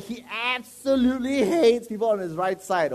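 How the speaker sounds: loud, emphatic warning about the boss. Acted. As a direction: press it on them loudly. Preacher acting out the boss's rules.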